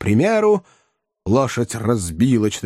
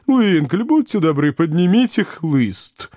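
higher-pitched polite call; calling out politely; 6 semitones higher than usual. A narrator's reading. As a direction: call across with a request, voice raised in pitch. Calling companion to fetch the whip. (вежливая просьба окликом)

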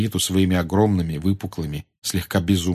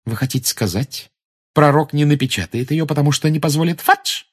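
mildly vexed, higher questioning tone; higher-pitched, irritated question; annoyed; 7 semitones higher than usual. A narrator's reading. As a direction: ask with a touch of exasperation. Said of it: "с досадой спросила Гермиона".

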